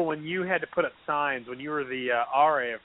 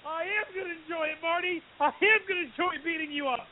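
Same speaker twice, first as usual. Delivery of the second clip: much higher, mock-gleeful villain voice; gleeful menace; 4 dB quieter than usual. Sid gloating at Marty, high mock-gleeful menace. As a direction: gloat like a grinning heel. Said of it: "And then he just does".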